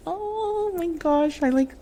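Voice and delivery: high, soft, slow "Oh my gosh", close to tears; welling up. Real, not acted. Remembering the first ultrasound, on the verge of crying. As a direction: let the voice catch and rise as tears come. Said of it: "I'm gonna cry thinking about that day".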